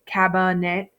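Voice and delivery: "cabinet" as CAB-uh-net; "cabinet" sounded out with a clear schwa; schwa demonstration. Shown as a demonstration. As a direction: stress the uh in the middle of "cabinet". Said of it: "It gives me a schwa sound".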